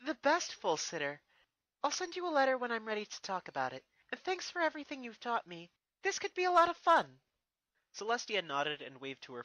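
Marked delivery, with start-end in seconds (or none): 0.1-7.1 put-on much higher, cheerful young voice; bright eager young princess; Twilight. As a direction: laugh then thank her teacher warmly. Twilight laughing, grateful.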